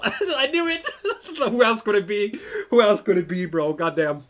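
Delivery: laughing through the words; amused, laughing out the guess. Real, not acted. Streamer laughing at guessing the driver, laughing.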